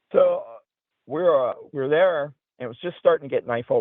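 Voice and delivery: ordinary delivery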